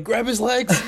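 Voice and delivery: high mock fan yell with a laugh; imitated yelling. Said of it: "He's just yelling".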